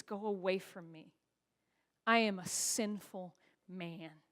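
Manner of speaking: low, soft, slowing plea; hushed, ashamed admission; humbled confession. Acted. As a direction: confess it quietly, weighing each word. Peter on his knees confesses.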